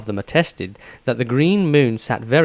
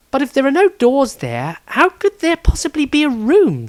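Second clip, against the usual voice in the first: much higher, doubting voice; puzzled lady's voice; 13 semitones higher, 4 dB louder than usual. Acted. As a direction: question him in disbelief. Rysandria voiced in story.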